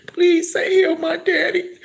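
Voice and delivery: begging through tears; tearful plea. Real, not acted. Daughter praying for her sick father, tearful.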